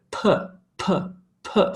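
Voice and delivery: P popped from the lips, separately; isolated P bursts with pauses between; lip consonant demo. Shown as a demonstration. Teacher showing a consonant uses lips, popped P demo. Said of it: "distinctly using my lips".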